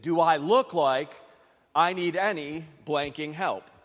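imitating an angry woman's snap; angry repeat. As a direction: push it harder, impatient and fed up. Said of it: "to which she repeated".

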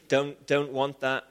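ordinary talk

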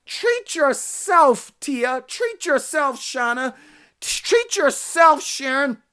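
treat yourself stretched very slow; drawn-out emphasis. Real, not acted. Preacher urging the congregation, very slow drawn-out phrase. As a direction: lean into treat yourself, slowly.

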